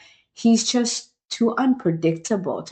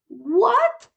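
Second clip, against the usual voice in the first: high, loud incredulous "What?"; shocked what; 3 dB louder than usual. Real, not acted. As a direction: gasp it out in disbelief. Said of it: "How? When? Why, Farhana?".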